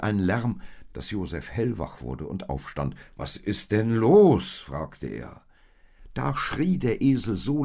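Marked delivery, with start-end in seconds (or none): 3.2-4.6 loud, high, drawn-out demand; exclaimed question, stretched at the end; roused and irritated. A narrator's reading. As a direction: cry out on being woken. Josef startled awake by the noise.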